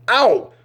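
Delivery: sharp high loud "ow" cry; pained yelp. Retold or imitated. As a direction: yelp in sudden pain. Eye punched during sex.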